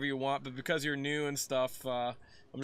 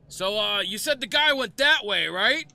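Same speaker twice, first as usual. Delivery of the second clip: loud, higher gruff guard asking; guard questioning loudly; 7 semitones higher, 9 dB louder than usual. Acted. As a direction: call out the question like a suspicious guard.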